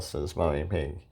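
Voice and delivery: plain reading voice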